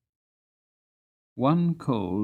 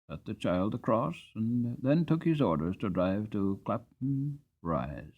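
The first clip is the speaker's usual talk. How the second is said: ordinary delivery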